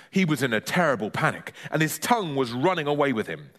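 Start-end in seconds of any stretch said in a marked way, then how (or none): none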